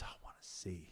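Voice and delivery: near-whispered retold answer; hushed plea. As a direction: murmur a humble request under the breath. Retells blind man's humble plea.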